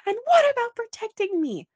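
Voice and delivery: high, loud pleading cry; hurt demand. Retold or imitated. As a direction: demand it like she's been wronged. Voicing Anna's hurt demand.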